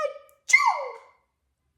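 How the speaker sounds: high laughing achoo; laughing mock sneeze. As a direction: sneeze it big for the kids. Acting the bear's sneeze.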